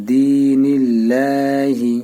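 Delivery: দিনিল্লাহি chanted very slowly, the long a held one alif; long vowel demo. Shown as a demonstration. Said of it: "এক আলিফ টান দিবেন".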